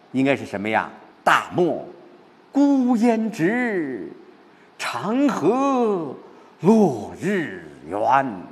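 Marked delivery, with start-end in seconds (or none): 1.2-8.4 “直” and “圆” stretched very long as stresses; slow recitation, earlier words carrying up to a long-held “直” and “圆”; 重音放慢拉长. Shown as a demonstration. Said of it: "把这两个重音呢给放慢". (“直”和“圆”放慢拉长、托起来)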